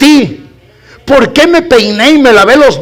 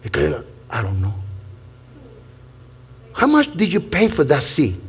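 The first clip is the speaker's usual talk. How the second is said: quiet mock question; 5 semitones lower, 5 dB quieter than usual. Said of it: "pregúntele".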